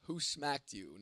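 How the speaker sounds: ordinary delivery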